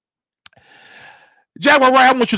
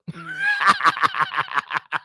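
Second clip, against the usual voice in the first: high shrieking laugh; 8 dB quieter than usual. Real, not acted. Laughs after rant.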